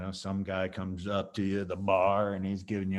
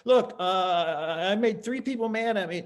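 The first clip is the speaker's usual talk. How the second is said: squeaky high apology, much higher; too high; 10 semitones higher, 4 dB louder than usual. Shown as a demonstration. Instructor showing the wrong, high-pitched apology. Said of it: "You can't say it from high".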